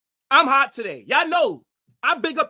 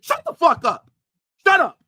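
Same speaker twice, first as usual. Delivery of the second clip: angry shout; 4 semitones higher, 6 dB louder than usual. Real, not acted. Fan ranting at his team's players, angry shout. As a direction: snap it out loud and higher, fed up.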